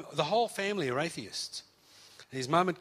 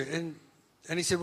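ordinary talk, nothing marked

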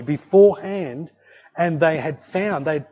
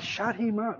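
hushed urgency; 5 semitones higher, 7 dB quieter than usual. Retold or imitated. Mother on phone, child screaming.

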